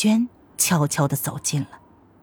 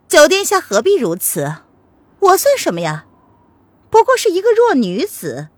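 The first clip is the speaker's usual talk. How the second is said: bitter, higher-pitched wronged woman; cold sneer; 5 semitones higher, 4 dB louder than usual. A narrator's reading. Lili disowns her sworn brother, sneering coldly. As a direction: open with a scornful laugh, then reproach him bitterly. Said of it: "黎黎冷笑着道".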